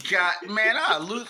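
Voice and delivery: ordinary talk, nothing marked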